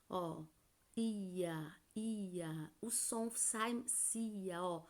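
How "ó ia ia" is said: ia said softly, drawn apart as a glide; gently sounding out ia, ia; syllable demo. Shown as a demonstration. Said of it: "Olha a palavra polícia".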